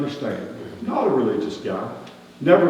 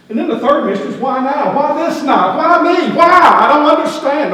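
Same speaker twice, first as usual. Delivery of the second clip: questions climbing higher and louder until he's nearly shouting; mounting bewildered shout; rising baffled outcry; 12 semitones higher, 8 dB louder than usual. Acted. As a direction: build each why until you're crying out. Shepherd building to baffled outcry.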